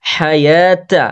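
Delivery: হায়াতা very slow, throaty h and long "yaa"; careful throat H. Shown as a demonstration. Teacher modelling for learners, slow careful হায়াতা with throaty h. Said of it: "খুব সুন্দর করে উচ্চারণ করতে হবে".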